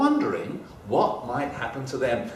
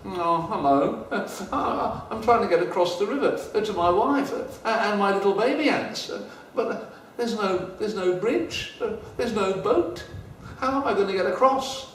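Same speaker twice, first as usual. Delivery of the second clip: high, hurried little ant voice; a small fretful ant pleading for help; worried ant; 7 semitones higher than usual. Acted. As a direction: ant far from his wife, voiced high and anxious. Ant explains his problem.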